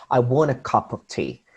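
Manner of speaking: "I want a cup of tea" run together, "a" and "of" as a lazy uh; connected "I want a cup of tea", small words reduced to schwa; natural schwa reduction. Shown as a demonstration. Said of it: "something called the schwa".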